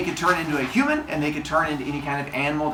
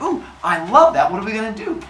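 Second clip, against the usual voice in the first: higher, gushing mock delight; raised pitch, eager put-on voice; excited girl; 7 semitones higher, 4 dB louder than usual. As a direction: imitate a thrilled girlfriend. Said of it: "She's like".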